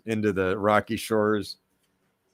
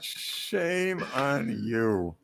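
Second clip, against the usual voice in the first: drawn-out mock shame; 2 semitones higher, 5 dB quieter, much slower than usual. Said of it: "mock scolding repeated".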